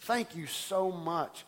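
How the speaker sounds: simply receive the praise gently; sincere thanks. Retold or imitated. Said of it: "I go".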